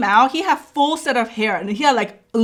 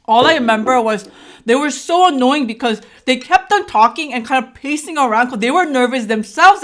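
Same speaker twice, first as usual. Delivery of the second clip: ordinary delivery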